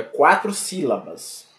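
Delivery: usual speaking voice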